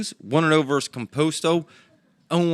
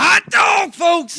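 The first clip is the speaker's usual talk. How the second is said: put-on high, loud old-timey shout; 1930s cartoon; 7 dB louder, much slower than usual. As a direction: yell it like a cartoon announcer. Mock hype exclamation.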